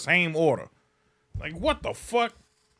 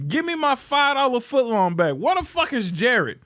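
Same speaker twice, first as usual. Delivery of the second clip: ordinary talk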